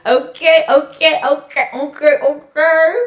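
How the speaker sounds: high, loud excited squeals; giddy loud okays after the poem. Real, not acted. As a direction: squeal it out, thrilled it's done. Hyped after finishing poem.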